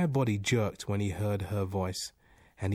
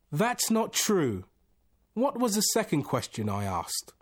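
high, loud, indignant objection; forceful demand; 10 semitones higher, 6 dB louder than usual. A narrator's reading. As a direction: protest hotly, demanding an answer. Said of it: "he demanded with some force".

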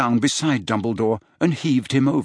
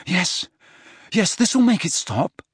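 anxious false reassurance; 7 semitones higher than usual. A narrator's reading. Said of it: "lied Harry".

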